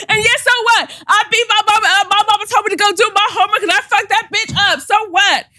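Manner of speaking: high, loud mock brat rant; defiant teen shouting. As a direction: scream it like an unruly twelve-year-old. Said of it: "She's like".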